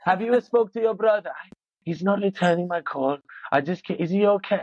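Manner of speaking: high, fretting mother voice; impression of mum. Son mimicking his mum fretting over an unanswered call, high-pitched impression. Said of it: "that's my impression of it".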